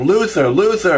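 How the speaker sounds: mock call out to someone; retold yelling. Wife calling her husband over, mild mock yell. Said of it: "And she's screaming".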